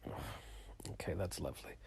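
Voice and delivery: breathy whisper, barely there; whispered reply. Retold or imitated. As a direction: drop to a dry little whisper. Reply to dad going to bathroom.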